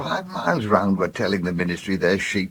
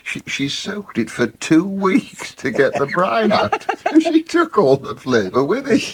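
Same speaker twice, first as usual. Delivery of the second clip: laughing while telling; 10 semitones higher, 5 dB louder than usual. Real, not acted. Laughing at an old cooking story.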